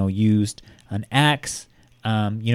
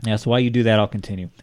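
ordinary delivery

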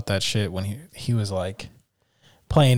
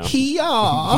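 squeaky mock holler; 14 semitones higher than usual. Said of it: "Nice, dude".

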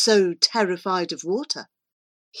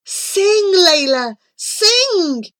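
high, loud plea; begging; 11 semitones higher, 6 dB louder, much slower than usual. A narrator's reading. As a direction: implore urgently, calling out. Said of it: "he begged".

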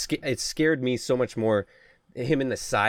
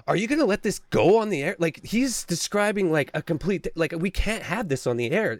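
retold assistant; 7 semitones higher than usual. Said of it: "he's hearing from his assistant".

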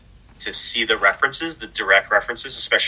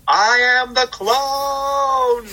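high, slow, drawn-out dramatic wail; melodramatic cry; much slower than usual. Retold or imitated. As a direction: cry it out like a soap opera hero. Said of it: "and then goes".

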